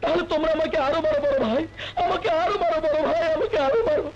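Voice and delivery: high, anguished plea breaking into crying; tearful pleading. Acted. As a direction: beg through tears, pitch held high. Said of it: "কাঁদিস না ভাই".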